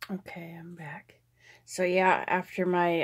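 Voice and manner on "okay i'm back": whispering. Real, not acted. Returns to the camera whispering.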